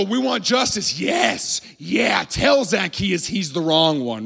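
high, eager mock clamor; fired-up crowd. Crowd demands justice from Jesus, acted fired-up clamor. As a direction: cheer on the punishment, drawing it out. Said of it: "almost, like, foaming at the mouth".

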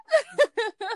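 high, breathy laugh; laughter. Real, not acted. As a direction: laugh at your own joke. Laughing at herself.

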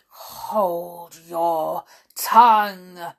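very slow, loud, strained order; furious queen. A narrator's reading. Queen turning purple at Alice, slow furious command. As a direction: drag out each word with rage. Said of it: "turning purple".

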